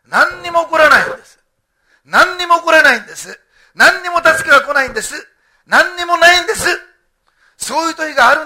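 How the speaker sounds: loud, high, forceful preacher's cry; shouting the same lament over and over; full-force preaching. Real, not acted. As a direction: pound each line out to the hall. Preacher hammering despair.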